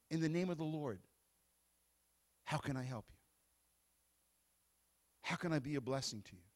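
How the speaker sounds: soft, low offer of help; very calm. Shown as a demonstration. Preacher models calm words to a frantic person, soft and low. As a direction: speak into the storm gently, like still water. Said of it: "step into that storm very calmly and say".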